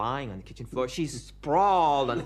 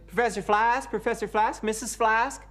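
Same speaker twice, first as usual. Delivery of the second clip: calling out; 8 semitones higher, 4 dB louder than usual. Acted. Visitor calls through house.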